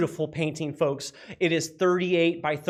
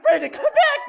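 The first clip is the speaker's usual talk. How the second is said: squealed plea; 5 dB louder than usual. Real, not acted. Same plea, pitched much higher.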